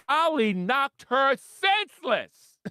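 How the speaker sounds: high, slow, drawn-out emphasis; big slow knockout announcement; gleeful punchline. Real, not acted. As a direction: stretch it out high with relish.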